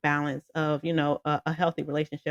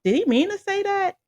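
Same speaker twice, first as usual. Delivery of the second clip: very high, baffled question; mock-baffled, pitched way up; incredulous question; 4 dB louder than usual. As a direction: sound honestly thrown by it. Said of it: "So I'm like".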